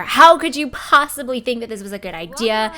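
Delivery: half-acted angry outburst at her partner; mock yell. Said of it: "She's gonna yell at her".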